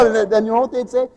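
ordinary talk

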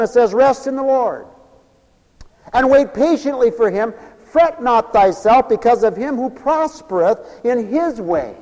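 loud, high preaching of scripture; preaching at full force. Acted. Preacher reading scripture to the church, loud fervent preaching. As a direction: proclaim the verses to the church.

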